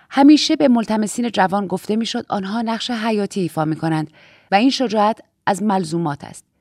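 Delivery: ordinary delivery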